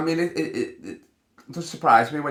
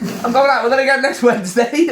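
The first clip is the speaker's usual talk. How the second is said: mock casual offender; 9 semitones higher, 4 dB louder than usual. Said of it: "It's like".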